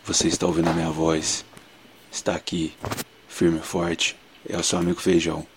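low, soft ASMR murmur. Pretend. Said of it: "E eu vou fazer um A S M R".

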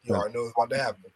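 rapid. Real, not acted. Fast aside repeated.

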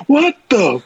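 high, drawn-out mock angry yell; exasperated high-pitched outburst; Seabass pissed off. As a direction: imitate a furious teammate calling out the pilot. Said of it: "Bass is pissed at this point".

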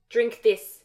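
loud curt command. A narrator's reading. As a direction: order her to drink it. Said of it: "he commanded".

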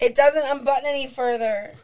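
high and loud whiny complaint. Acted. Shirt stuck, complaining.

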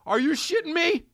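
high squeaky disbelief; shrill mock outrage; outraged disbelief. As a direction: pitch it way up in shock. Outraged at horse on plane.

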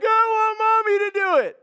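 high, loud mocking kid's wail; mock the whiny child begging for mommy. God mimicking us as a scared child, high loud whine. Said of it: "You're like".

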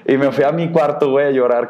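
ordinary talk

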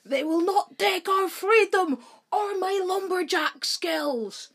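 high, rousing mock-Scottish battle cry; rally the troops in a hammed-up Scottish accent; Mel Gibson. Podcaster imagines a lumberjack freedom speech, mock-Scottish battle cry. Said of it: "you just see Mel Gibson in a tartan shirt".

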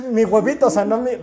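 high, loud mock panic; flustered backtracking. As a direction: fumble a correction in exaggerated embarrassment. Retelling his flustered excuse. (apuro exagerado, corrigiéndose a gritos)

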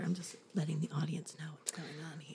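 fast whisper aside. Real, not acted. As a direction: whisper it quickly to the audience. Narrating for audience during reading.

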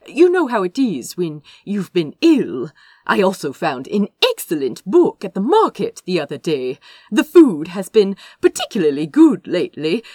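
put-on flustered young prince chattering his way out, fast and loud; cheery evasive Azra. A narrator's reading. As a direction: cover up with too much cheer. Azra brushing off his brother.

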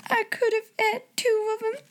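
high muffled sobbing; crying into pillow. A narrator's reading. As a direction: weep into the pillow. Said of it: "heard her cry into her pillow".

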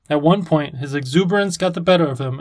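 usual speaking voice

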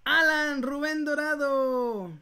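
high, slow winner reveal; booming prize announcer. Acted. Anuncia ganador del sorteo. (anunciador de premios con voz potente)

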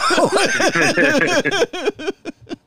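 very high squealing laugh; real high giggle; shrieking laugh. Real, not acted. Laughing at the story.

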